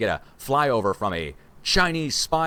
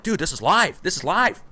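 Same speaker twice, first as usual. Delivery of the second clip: raised, urgent call to stop; urgent warning; 9 semitones higher, 6 dB louder, slower than usual. Retold or imitated. Staff urgently warning the screaming guy, raised alarmed call. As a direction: hurry to warn him the mic is live. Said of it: "They're like".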